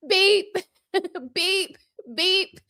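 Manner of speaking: high mock smoke detector beeps; imitated beeping. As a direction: chirp like a dying smoke alarm.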